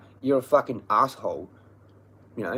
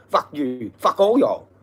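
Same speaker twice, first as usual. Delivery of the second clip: loud shouted curse, rising higher; angry outburst; 3 semitones higher, 7 dB louder, slower than usual. Real, not acted. Speaker damns everyone who wronged them, real angry shout. Angry rant at absent enemies.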